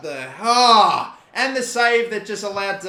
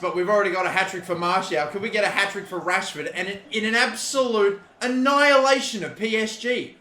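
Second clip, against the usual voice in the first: normal talking voice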